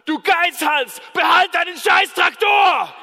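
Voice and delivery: high, loud angry yell; the farmer shouts at his neighbour in fury; furious shout. Acted. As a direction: bellow it at the neighbour in rage. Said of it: "der Bauer steht da und sagt".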